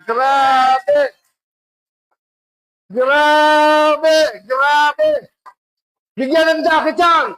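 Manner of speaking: loud, rising cries of amazement; excited shout. Real, not acted. Preacher to the congregation, shouting in amazement. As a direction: shout your wonder to the crowd.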